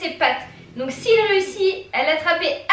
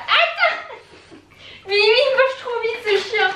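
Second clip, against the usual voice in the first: surprised; 8 semitones higher, slower than usual. Real, not acted. Dog eats treat too fast.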